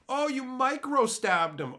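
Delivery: high-pitched mocking accuser; mock accusation. Accuser claims someone micro stabbed him, mock indignant.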